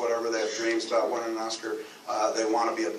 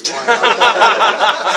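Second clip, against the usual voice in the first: slow, loud, laughing through it; 9 semitones higher, 14 dB louder than usual. Real, not acted. Laughing about the movie on the plane. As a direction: let the laugh take over. Laughing at his own joke.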